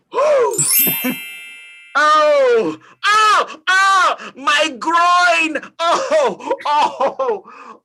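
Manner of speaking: high, loud yelps of pain; pained cries. Acted. Old Scrooge straining his groin on a chair, loud pained yelps. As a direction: yelp as the groin gives out.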